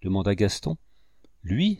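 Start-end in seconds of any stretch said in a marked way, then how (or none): 1.4-1.8 astonished question, pitch leaping up; a sharply rising, amazed query; stupefied. A narrator's reading. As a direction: react in stupefaction. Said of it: "s'écrièrent à la fois ... avec stupéfaction".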